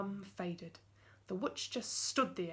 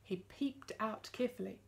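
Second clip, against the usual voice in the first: usual speaking voice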